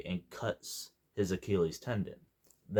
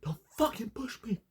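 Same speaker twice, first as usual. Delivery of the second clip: much higher, put-on voice in a hammed-up snap; mock angry retort. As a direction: imitate a touchy foreigner losing it. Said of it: "the Swedish guy gets angry".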